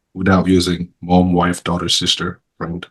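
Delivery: ordinary delivery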